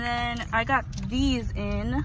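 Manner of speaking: lip held open by finger; talking with lip pulled down; finger pulling lip down. Real, not acted. Seen 0: Finger pulls lower lip down to show braces; returns 5.8-8.8.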